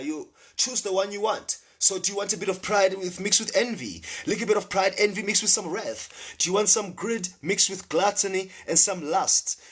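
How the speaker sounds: high-pitched sales patter; sin vendor. Acted. Offering people mixes of sins to choose, high vendor patter.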